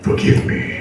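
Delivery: breathy, low moaning Brit; a hint of Boris groaning in bed; Boris Johnson impression. Comic mock moans as Boris reading the Kama Sutra. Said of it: "This is my impression of Boris Johnson".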